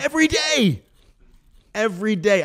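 high-pitched incredulous exclamation; exasperated amusement. Real, not acted. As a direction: burst out in amused disbelief, way up high. Exclaiming over colleague's daily habit.

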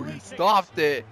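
ordinary delivery

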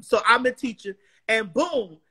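ordinary talk

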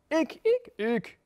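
high, eager animal cries; overlapping-style eager squeals; calling out. A narrator's reading. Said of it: "riepen ze allemaal".

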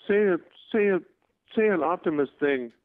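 high, eager child imitation; little boy. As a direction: plead like a young fan. Retold little boy requesting catchphrase.

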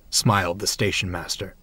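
ordinary delivery

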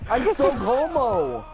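slow, high goofy voice; silly put-on voice. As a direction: stretch it out in a silly voice. Silly boast mid-chase.

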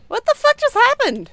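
startled, loud and fast; shocked outburst. Real, not acted. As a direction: blurt it out in disbelief. Sudden shock in ghost hunt.

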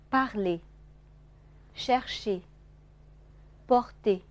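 r in "Parler, chercher, porter" said lightly, not forced; light R before consonant. Shown as a demonstration. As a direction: model the words slowly apart with a light French r. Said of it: "不用发得很重啊". (辅音前的r轻读示范)

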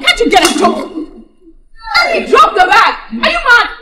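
wife ordering her husband to carry the bag; angry yelling. Acted. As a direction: bark orders at him.

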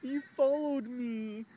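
high, loud, slow whiny mock; mocking ex accusation. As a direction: caricature her report. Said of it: "she puts in the police report".

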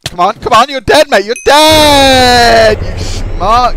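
loud, high shout with "dead" drawn out; taunting battle shout. Real, not acted. Gamer threatening an opponent mid-fight, taunting shout. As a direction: yell threats at your rival mid-fight.

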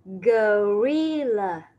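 "gorilla." drawn out very slowly, syllable by syllable: go-ri-lla; teacher slowly models "gorilla." for children. Shown as a demonstration. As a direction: stretch out each syllable of "gorilla.". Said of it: "một con khác nữa đó là con".

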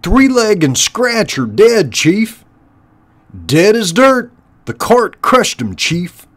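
high, loud underling voice; squeaky, high-pitched rat lackey; henchrat minion. Acted. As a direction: pipe up with a report to the boss. Henchrat reporting to the chief.

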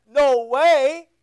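a stern mother's loud no; firm loud no. Retold or imitated. Mom refuses son going out, loud firm no. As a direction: scold like a mom refusing her twelve-year-old. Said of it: "Or she said".

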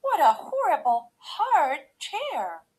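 high, loud complaint; indignant cry. A narrator's reading. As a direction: cry out in outrage at the discomfort. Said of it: "she cried".

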